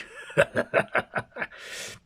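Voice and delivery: airy villain chuckle; breathy evil laugh. Acted. Hyde mocking Ava, breathy evil laugh. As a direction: laugh at their weakness.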